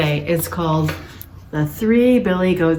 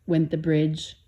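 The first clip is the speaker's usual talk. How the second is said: ordinary delivery, nothing marked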